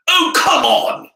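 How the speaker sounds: high, loud, slow exasperated cry; fierce disappointment. A narrator's reading. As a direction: whine it out in outrage. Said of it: "with fierce disappointment".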